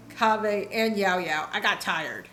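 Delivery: ordinary delivery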